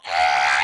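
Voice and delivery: loud mock scream; imitated girls' scream. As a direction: let out a sudden hammed-up shriek.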